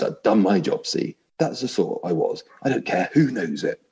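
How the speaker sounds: a self-righteous ghost voice pushed up high; indignant big ghost. Acted. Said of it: "I'll try vaguely to put on different voices".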